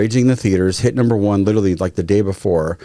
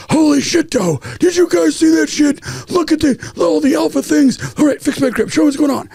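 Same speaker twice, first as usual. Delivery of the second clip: very high, strained excited exclaiming; imitated astonished excitement. Director has just watched perfect shooting, imitated astonished excitement. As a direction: mimic a director blown away, pitched way up.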